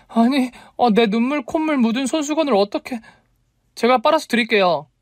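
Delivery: very high, embarrassed heroine; flustered. A narrator's reading. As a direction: blurt it out, pitched right up. 더러운 손수건에 당황한 여자. (당황해서 높게 외치듯)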